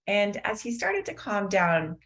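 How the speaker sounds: ordinary talk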